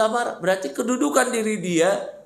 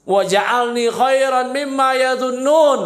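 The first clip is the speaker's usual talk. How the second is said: slow, raised Arabic recitation; higher, louder, measured prayer; 5 semitones higher, 5 dB louder, slower than usual. Shown as a demonstration. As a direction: recite the du'a loud and drawn out. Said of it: "doa ini, Pak".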